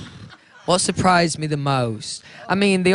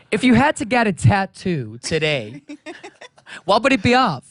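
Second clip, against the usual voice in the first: put-on American accent; 3 semitones higher than usual. Asking about a tattoo, put-on American accent. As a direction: have a go at an exaggerated American accent. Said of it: "in an American accent".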